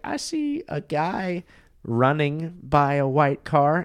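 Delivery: slow, high put-on voice; very fake voice. Date describing what she sees by the car, slow fake falsetto. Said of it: "like, very fake sounding voice".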